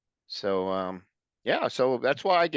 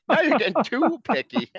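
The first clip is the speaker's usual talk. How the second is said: high laughing protest, words through giggles; 14 semitones higher, 7 dB louder than usual. Real, not acted. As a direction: laugh it out, teasing your friend.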